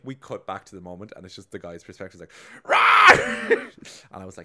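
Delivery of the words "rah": very high, loud battle yell; hammed-up attacking scream; war cry roar. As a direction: roar like a charging warrior. Spearman's war cry in skit.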